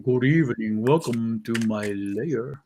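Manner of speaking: slow, low vampire welcome; Dracula voice. Acted. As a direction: drag it out like a creepy count. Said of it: "The dude Dracula thing".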